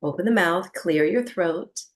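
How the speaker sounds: normal talking voice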